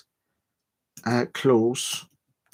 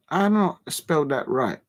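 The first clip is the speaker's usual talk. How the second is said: usual speaking voice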